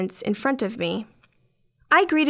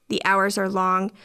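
ordinary delivery, nothing marked

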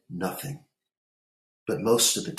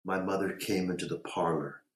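ordinary delivery, nothing marked

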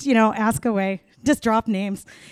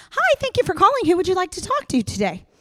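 much higher sweet receptionist voice; sing-song phone greeting, pitched up; office phone voice; 9 semitones higher than usual. Retold or imitated. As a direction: chirp it like a friendly switchboard. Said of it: "did my office voice".

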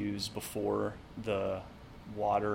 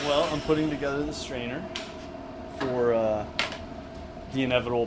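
raised voice over a loud saw; shouting over table saw; 3 semitones higher, 3 dB louder than usual. Real, not acted. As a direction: call out over shop noise. Talking over a running saw.

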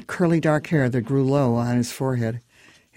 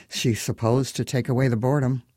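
plain reading voice

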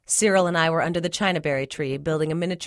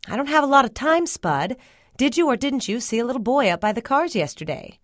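higher, insistent mama voice; impatient mama; 7 semitones higher than usual. A narrator's reading. Mama in a hurry questions her daughter, impatient. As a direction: demand an answer in a raised pitch.

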